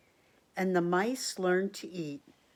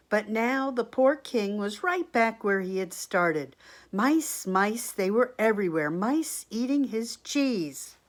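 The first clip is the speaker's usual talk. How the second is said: louder, dramatic storybook lament; storybook read-aloud; 3 semitones higher, 6 dB louder than usual. A narrator's reading. Reader to children as mice overrun the king, storybook read-aloud. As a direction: build up the comic dismay for the children.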